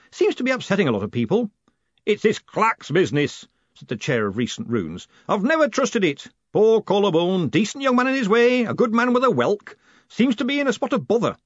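put-on high-pitched, animated old academic; chattering senior wizard. Wizards discussing poor Collarbone's trouble, high and animated. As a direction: gossip loudly in a high character voice.

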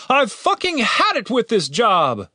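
fed-up, high-pitched outburst; angry shout. A narrator's reading. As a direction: shout it slowly, utterly fed up. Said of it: "shouted the leader".

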